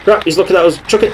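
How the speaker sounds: high, loud panicked warning; sudden alarm at being spotted. Real, not acted. Tank spots them.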